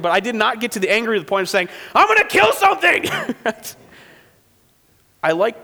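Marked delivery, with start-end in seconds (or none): 1.9-3.0 high, loud hammed-up outburst; much higher, louder mock threat; mock rage. Mock overreaction about eaten sprouts. 3.1-3.7 high laugh. Real, not acted. Laughs after his mock outburst.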